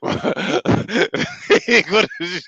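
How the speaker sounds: high, loud and laughing; laughing in disbelief. Real, not acted. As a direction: stretch it out, can't stop laughing. Mocking the nine-case camp news.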